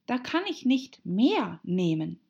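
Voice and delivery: annoyed. A narrator's reading. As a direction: object with a touch of pique. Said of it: "erwiderte Alice verärgert".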